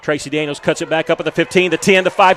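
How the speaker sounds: climbing to a loud, high call at the goal line; real excitement rising into a touchdown shout; excited play call. Real, not acted. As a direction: build the call as he breaks free. Touchdown run called live.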